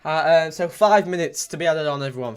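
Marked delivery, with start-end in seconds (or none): none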